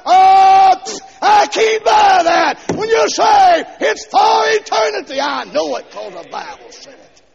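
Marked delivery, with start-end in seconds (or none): 0.0-5.2 high, loud scornful dismissal; scoffing shout. Real, not acted. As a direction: scoff it off with contempt. Preacher scoffing loudly.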